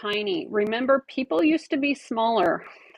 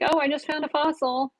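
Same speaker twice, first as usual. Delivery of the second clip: a lightly voiced excited boy, higher; son's discovery; 7 semitones higher, 3 dB quieter than usual. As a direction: quote the son brightly, light touch. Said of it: "and he's like".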